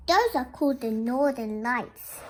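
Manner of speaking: usual speaking voice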